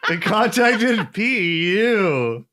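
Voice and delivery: slow, loud, high laughing drawl; teasing the name, laughing. Real, not acted. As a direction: stretch it out through laughter.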